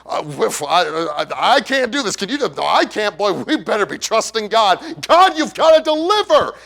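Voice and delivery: scared disciple begging God for power; nervous disciples. Retold or imitated. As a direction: fret out loud, then plead with God at the top. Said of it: "saying something like this".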